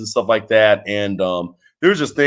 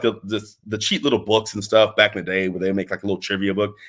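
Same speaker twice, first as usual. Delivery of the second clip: normal talking voice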